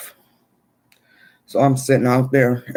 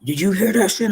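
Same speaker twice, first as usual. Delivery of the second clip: higher, shaken friend asking; homeboy's voice; 8 semitones higher than usual. Retold or imitated. Friend rushing over after the shooting, higher and rattled. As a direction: play the rattled homeboy, pitched up. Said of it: "he like".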